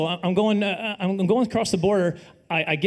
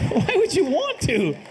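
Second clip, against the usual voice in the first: mock disbelief; 7 semitones higher, slower than usual. Incredulous reply to the guy.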